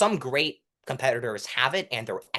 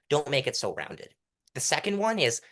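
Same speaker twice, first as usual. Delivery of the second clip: ordinary talk, nothing marked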